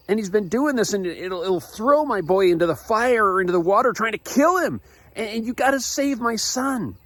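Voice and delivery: high-pitched, frantic father begging; acted-out panicked pleading at a high pitch; yelled desperate plea. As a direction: beg urgently for your child's rescue. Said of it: "yells out".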